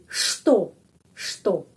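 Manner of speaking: что said shto, with sh; что slowly, sh not ch; pronunciation demo. Shown as a demonstration. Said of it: "huruf C pengucapannya sh". (что dibaca shto)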